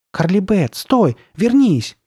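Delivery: high, loud urgent call; calling after someone. A narrator's reading. Sabrina to fleeing Carly Beth, calling out. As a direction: shout for her to come back.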